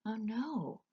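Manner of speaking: soft, hushed gasp of dismay; soft whispered dismay. A narrator's reading. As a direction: breathe it out in shock. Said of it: "whispered Despereaux".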